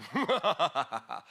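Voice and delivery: mock evil cackle, low and rapid; a playful villain's laugh, bursting out; devilish cackle. Preacher imitates the devil appearing to you, devilish cackle. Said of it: "Me salió medio diablito".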